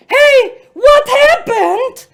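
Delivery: loud, high, slow cartoonish call; hammed-up heroic "hey", drawn out and booming; officer calling out. Officer walks up to Olivia's table, hammed-up loud concern.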